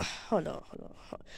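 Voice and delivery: very soft, fading repeats; hushed realization. Pretend. Realizing the monster has gone, hushed realization. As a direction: let it fade to almost nothing.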